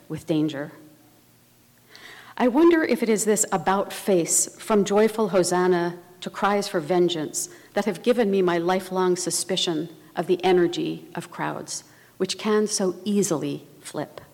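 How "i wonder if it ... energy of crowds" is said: ordinary talk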